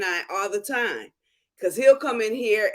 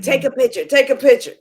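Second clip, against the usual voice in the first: eager, louder urging; excited nail tech; 4 semitones higher, 8 dB louder than usual. Retold or imitated. Mimicking the nail tech's eager request, louder. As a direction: push it with mock delight. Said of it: "And he's like".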